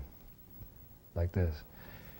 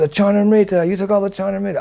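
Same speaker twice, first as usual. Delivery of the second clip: accusing cry; 11 semitones higher, 6 dB louder than usual. Retold or imitated. Boys accusing the narrator.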